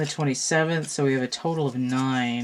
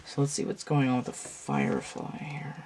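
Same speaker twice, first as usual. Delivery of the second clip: soft unvoiced whisper; 6 dB quieter than usual. Real, not acted. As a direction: breathe the words out. Unvoiced aside to cards.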